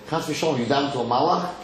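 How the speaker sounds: ordinary delivery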